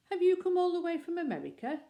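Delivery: loud, high reporter question; reporter calling out. Acted. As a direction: call out over the crowd. Reporter shouting question in crowd.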